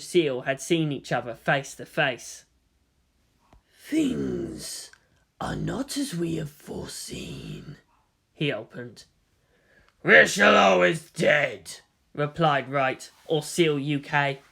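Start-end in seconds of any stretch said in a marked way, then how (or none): 3.8-7.8 slow secretive whisper; old cyborg man whispering to conspirators; hushed whisper. Acted. Old man opens secret meeting. 10.0-11.8 slow, grave official; a hint of a stern British bureaucrat; lightly voiced Wright. Wright replies.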